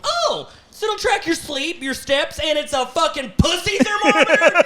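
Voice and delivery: very high-pitched sarcastic rant; exasperated outrage. Retold or imitated. Husband outraged by wife's ovulation ring, exasperated. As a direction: rant incredulously, voice way up.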